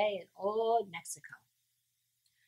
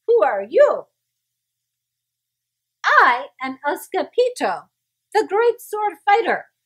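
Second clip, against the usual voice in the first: loud, higher heroic boast; proud announcement; 8 semitones higher, 9 dB louder than usual. Acted. Kitten introducing himself proudly, loud. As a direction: boast like a grand swordsman.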